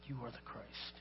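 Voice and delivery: very soft, low, reverent repeat; hushed declaration. Acted. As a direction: let "You are the Christ" drop to a low hush. Peter's declaration repeated in a hush.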